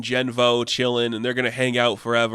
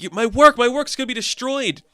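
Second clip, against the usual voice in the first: high, fretful protest; mock distress; 10 semitones higher than usual. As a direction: lament your work in a retold panic. Yulktar frets over his work.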